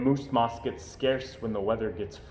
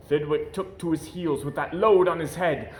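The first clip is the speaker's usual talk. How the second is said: ordinary delivery, nothing marked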